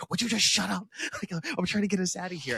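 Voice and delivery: high, fast, laughing exasperation; exasperated Woody. Retold or imitated. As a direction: snap at him while laughing. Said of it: "Woody's like".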